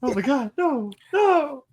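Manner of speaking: rising high wail; mock despair.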